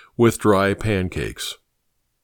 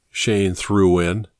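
plain reading voice

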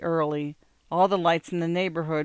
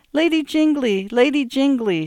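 put-on high, loud poem in an English accent; sing-song recitation in an English accent; 9 semitones higher, 8 dB louder than usual. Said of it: "the pure accents of the Sweeney's nurse".

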